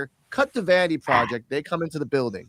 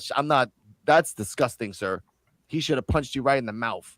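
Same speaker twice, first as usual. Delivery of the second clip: ordinary delivery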